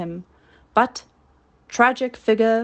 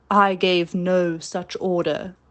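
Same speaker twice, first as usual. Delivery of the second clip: ordinary delivery, nothing marked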